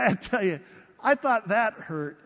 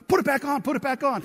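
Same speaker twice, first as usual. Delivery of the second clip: urgent, louder, higher repeated order; alarmed command; 7 semitones higher, 7 dB louder, faster than usual. Retold or imitated. Doctor sees the gash and orders him to cover it, alarmed. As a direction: snap it out in a hurry, alarmed.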